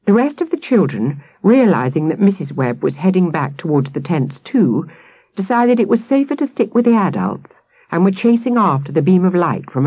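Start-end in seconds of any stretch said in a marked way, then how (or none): none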